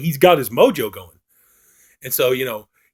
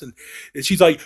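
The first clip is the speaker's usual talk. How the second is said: normal talking voice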